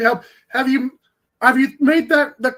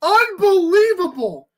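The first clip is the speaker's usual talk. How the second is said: drawn-out disbelief; 4 semitones higher, slower than usual. Real, not acted.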